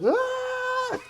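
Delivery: high, loud comic scream; retold scream. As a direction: let out a high scream through laughter.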